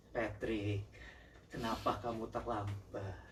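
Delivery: low, hushed and restrained question; controlled anger. Shown as a demonstration. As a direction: keep the anger in, speaking low and soft. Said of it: "intonasinya itu di".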